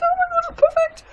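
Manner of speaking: high, fast squeal of delight; thrilled squeal. Real, not acted. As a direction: burst out with joy. Thrilled at finished soap.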